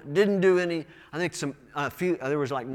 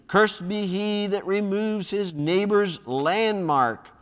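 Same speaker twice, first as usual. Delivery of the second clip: slow, higher proclamation; raised, measured reading voice; loud voice; 4 semitones higher, 3 dB louder, slower than usual. Acted. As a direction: call the curse out to the assembly. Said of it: "with a loud voice".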